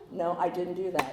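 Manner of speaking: normal talking voice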